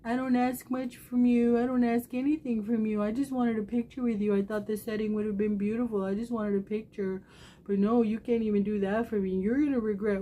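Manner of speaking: mock hurt pouting plea; whiny bratty self. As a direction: replay your own bratty whining at your son. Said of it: "I acted like a brat".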